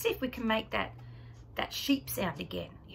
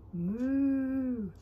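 cow moo; 3 semitones higher than usual. Pretend. Cow sound for child.

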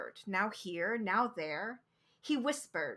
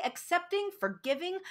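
plain reading voice

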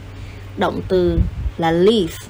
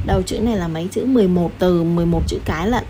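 normal talking voice

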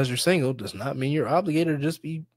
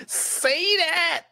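high squealed agreement; emphatic cheering on; 4 dB louder than usual. Real, not acted. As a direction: squeal it in delighted approval. Cheering on the host's point.

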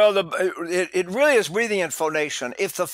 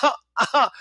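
put-on baby's cry; 7 semitones higher, 3 dB louder than usual. Shown as a demonstration. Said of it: "when you cry like a baby, you go".

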